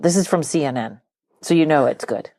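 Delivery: normal talking voice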